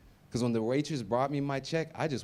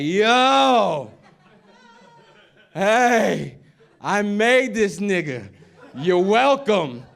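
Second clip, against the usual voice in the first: booming, slow bragging dad voice with a laugh; loud brag; 6 semitones higher, 12 dB louder, much slower than usual. Retold or imitated. Dad on stage booming his brag at the audience. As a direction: shout it to the room, proud and slow. Father grabs mic, brags to crowd.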